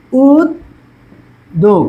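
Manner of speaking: উদ্যোগ drawn out very slowly, doubling the d; slow demonstration. Shown as a demonstration. Teacher slowly sounding out উদ্যোগ for students. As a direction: stretch উদ্যোগ, hold the double d. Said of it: "দেখো ওকারান্ত আছে".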